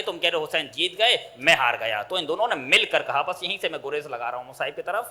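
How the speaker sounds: ordinary talk, nothing marked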